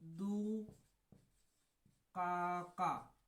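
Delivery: दुकाका stretched very slowly; drawn-out name. Shown as a demonstration. Teacher modelling दुकाका for students, very slowly stretched. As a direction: hold every syllable of दुकाका. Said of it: "दू से दुकाका".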